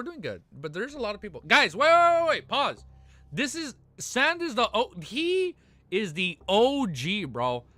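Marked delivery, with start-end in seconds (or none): 1.5-2.8 loud, high burst of excitement; excited yelling. Real, not acted. Streamer yelling to chat on spotting an old friend, excited shout. 3.3-7.6 high, slow drawn-out praise; excited hype. Real, not acted. Streamer drawn-out hype for an old friend in chat.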